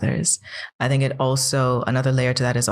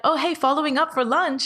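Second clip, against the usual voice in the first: chirpy follow-up; 13 semitones higher, faster than usual. Retold or imitated. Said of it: "I would do the deal of like".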